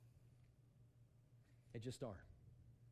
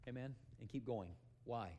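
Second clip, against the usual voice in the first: ordinary delivery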